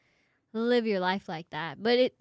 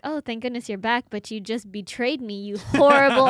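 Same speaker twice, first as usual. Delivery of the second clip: mock betrayal; 8 dB louder than usual. Child's mock-betrayed reaction, retold.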